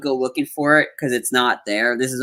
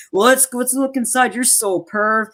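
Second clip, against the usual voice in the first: retold Cable; 8 semitones higher than usual. Voicing Cable's retort in retelling.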